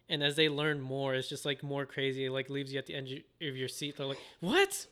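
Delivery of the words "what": very high, incredulous question; hammed-up high-pitched surprise; mock shocked disbelief. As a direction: squeak out an astonished reaction. Voicing viewers' shock at revelations.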